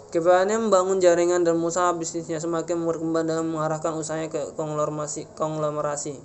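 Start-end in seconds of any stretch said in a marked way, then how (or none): none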